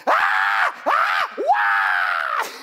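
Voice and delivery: loud frantic shrieks; wife screaming in three feet of water; panicked screaming. Retold or imitated. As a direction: scream like you're drowning. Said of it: "She's just screaming".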